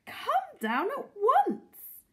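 high, loud, drawn-out call; urgent, delighted invitation; eager cry. A narrator's reading. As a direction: call him down excitedly. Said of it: "Missus Silver cried".

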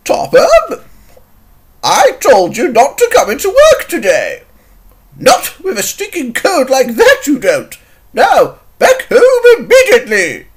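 high, loud fussing reprimand; indignant scolding. Acted. Scolding Topham for working with a cold, indignant.

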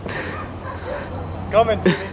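hushed mock warning. Quiet warning to Timmy.

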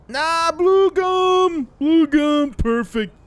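high, loud, stretched-out cheer; drawn-out delight. Acted. Kid finds blue gum at the soda machine, delighted. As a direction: savor each word in a high, loud drawl.

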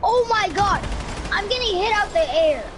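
loud, high outraged yell; raging gamer. Real, not acted. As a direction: shout at getting shot down. Getting shot down mid-game.

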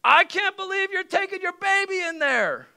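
imitating an outraged stranger's high yell; hammed-up high-pitched scolding. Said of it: "she yells something like".

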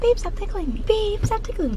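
high mock girly protest; girly whine. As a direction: imitate a whiny girlfriend. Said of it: "'cause you give me, like".

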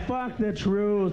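ordinary delivery

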